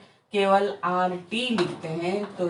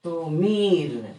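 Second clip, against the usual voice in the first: slow syllable demo; 2 semitones lower, much slower than usual. Shown as a demonstration. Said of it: "जैसे आपको कहना है समीर".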